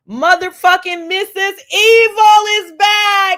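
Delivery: hammed-up villain entrance shout, slow and loud; big announcer shout. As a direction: bellow it like a ring announcer calling in the villain.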